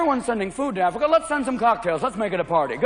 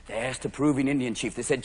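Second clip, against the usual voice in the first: normal talking voice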